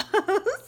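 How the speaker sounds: quick bright giggle at her own joke; short high laugh. Real, not acted.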